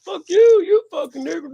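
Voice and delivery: high and loud retold yell; shouting stranger. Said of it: "He's like".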